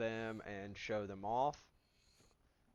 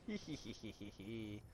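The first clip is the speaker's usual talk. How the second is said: cartoon laugh; 2 semitones lower, 10 dB quieter than usual. Laughing after zapping wasps.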